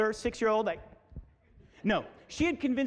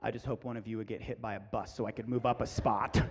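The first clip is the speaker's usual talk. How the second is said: low, muted monotone grumble; resentful wannabe; 11 semitones lower, 4 dB quieter than usual. Retold or imitated. Wannabe girl watching the other two, resentful. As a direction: imitate a bitter hanger-on under the breath. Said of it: "wannabe looking at those two like this".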